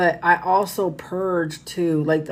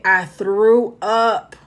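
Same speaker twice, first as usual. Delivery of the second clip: ordinary talk, nothing marked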